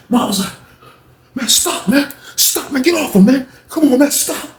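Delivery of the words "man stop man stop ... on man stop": imitated urgent plea. Imitating man breaking up fight.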